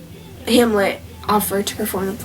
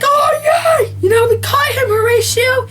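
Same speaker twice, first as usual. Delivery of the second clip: high-pitched victory yell; excited shout; 13 semitones higher than usual. Retold or imitated. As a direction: cheer like you just caught the culprit. Hamlet celebrating catching Claudius.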